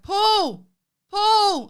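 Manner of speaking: high, loud frightened call. Retold or imitated. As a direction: call him from the next room. Calling out in paranoid panic.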